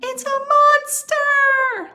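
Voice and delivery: hammed-up high frightened moan; mama bear moaning. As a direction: moan it in mock horror. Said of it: "moaned Mama Bear".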